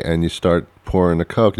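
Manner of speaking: ordinary delivery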